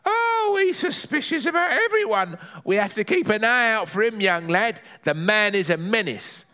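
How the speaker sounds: granny warning of a menace, high-pitched; Granny figure, warning. Acted. Granny warns young lad about the neighbour, high grumbling voice.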